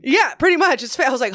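ordinary delivery

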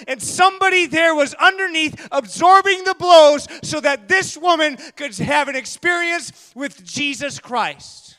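sermon builds to a loud, high shout; preaching at full force. Acted. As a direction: preach it, rising to a roar.

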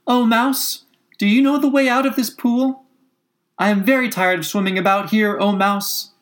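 high, lifted little-Alice voice; Alice addressing the mouse. A narrator's reading. Alice asks the mouse the way out, high-pitched appeal. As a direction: make Alice call out politely in a high voice.

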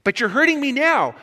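high, loud acted wail; freaking out. Ghost complaining to the angel, panicked wail. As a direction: cry out in pained protest. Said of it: "the ghost is starting to freak out".

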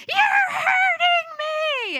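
put-on very high, slow, loud child wail; child screaming. Daughter screaming at mom in the parking lot, a high drawn-out wail. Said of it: "flopping all over the place screaming".

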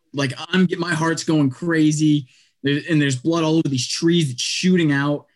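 normal talking voice

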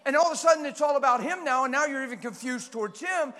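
loud, high preaching cadence; full-force preaching. Real, not acted. Preacher warning the congregation, loud. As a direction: drive the point home hard.

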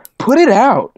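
mock exclamation. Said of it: "and everyone's like".

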